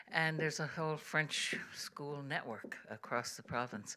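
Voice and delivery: whispered aside. Real, not acted. Aside while introducing song.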